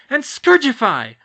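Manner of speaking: high, slow, loud spell cry; spell shout. Acted. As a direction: fling the spell triumphantly. Duel spell cast.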